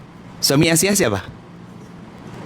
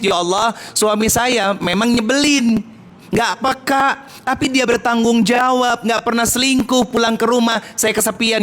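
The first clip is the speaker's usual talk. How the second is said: imitating a wife's plea; 7 semitones higher than usual. Wife voiced praying to God.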